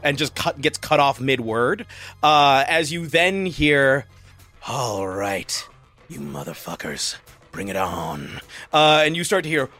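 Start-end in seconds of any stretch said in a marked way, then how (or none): 4.7-8.4 low quiet menacing growl; tough gunman. Acted. Gunman in the cave daring attackers, low menacing growl. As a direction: dare them low and hard.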